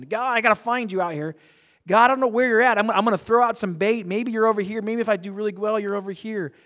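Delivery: hammed-up anxious believer throwing out bait; mock seeker calling out to a far-off God; frantic searcher.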